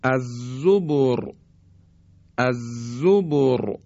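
الزبر slowly, ending on a thick R; heavy ra. Shown as a demonstration. Teacher repeating an example for learners, الزبر with heavy ra. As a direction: draw out الزبر and close on heavy ra.

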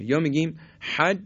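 normal talking voice

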